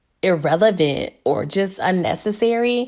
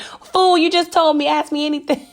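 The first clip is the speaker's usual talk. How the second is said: exasperated outburst; 9 semitones higher than usual. Retold or imitated. Scolding the character in a retold reaction.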